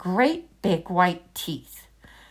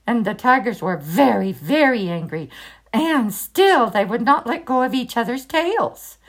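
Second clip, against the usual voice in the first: plain reading voice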